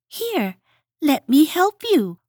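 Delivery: high eager little voice; helpful Hedgehog. A narrator's reading. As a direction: offer eagerly. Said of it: "offered Hedgehog".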